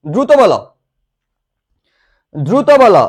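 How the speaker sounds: loud nudge for a fast reply; urgent prompting. Real, not acted. Teacher urges class to answer quickly.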